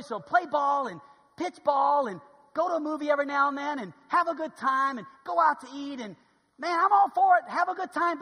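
loud, high encouraging call; rally them to enjoy life; enthusiastic cheer. Real, not acted. Preacher urging church to have fun, loud cheerful call.

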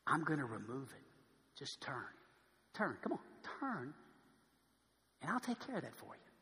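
low, soft, gentle pleading; soft voice of God. Acted. God gently calling the sinner to turn, soft. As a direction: coax them gently to turn. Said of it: "God says".

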